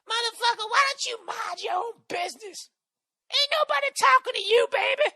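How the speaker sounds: high, loud falsetto yelling at a guest; screaming cleaning lady. Retold or imitated. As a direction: scream it at him like an angry housekeeper. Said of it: "her screaming at me".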